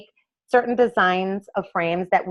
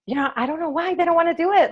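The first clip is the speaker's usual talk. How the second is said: frustrated; 9 semitones higher, faster than usual. Retold or imitated. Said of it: "I was really frustrated".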